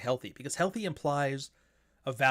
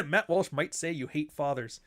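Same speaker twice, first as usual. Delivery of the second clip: ordinary delivery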